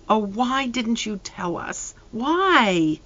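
soft, slow, trembling plea from a mother; a mother near tears, asking softly; quivering voice. Acted. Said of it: "in a quivering voice".

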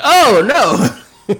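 high loud mock gasp of shock; mock dismay over the sad news. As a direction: play up the dismay. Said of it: "That you're like".

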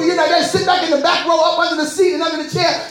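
normal talking voice

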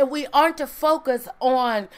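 usual speaking voice

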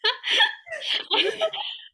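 high laugh with a rise. Real, not acted. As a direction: laugh in disbelief. Laughing about the luggage.

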